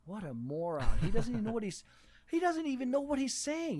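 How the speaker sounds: mock scorn. Listeners silently judging a learner who uses big words, mock scorn.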